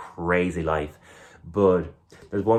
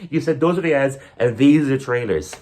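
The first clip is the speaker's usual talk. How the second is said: higher, louder indignant whoa; mock outraged protest; 7 semitones higher, 4 dB louder than usual. Comic cinemagoer protests an ad during trailers, mock outrage. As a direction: protest a broken deal, hammed up. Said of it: "I was like".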